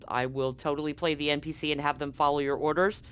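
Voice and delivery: ordinary delivery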